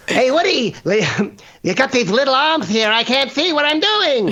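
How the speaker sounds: high, strained toy dinosaur moaning about little arms; a fretful toy T-Rex who can't see. Acted. Pal playing a game, doing T-Rex complaining to Woody, high and fretful. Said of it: "That does it".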